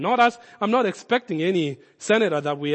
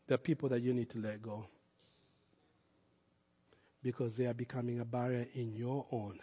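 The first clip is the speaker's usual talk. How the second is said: tender appeal; 6 semitones lower, 11 dB quieter than usual. Real, not acted. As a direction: speak quietly to each heart.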